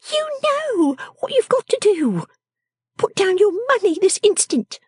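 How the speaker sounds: very high, commanding character voice; bossy little scold. Acted. Miss Wren demanding the wretched man's money, high and bossy. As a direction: order him about in a high, sharp little voice.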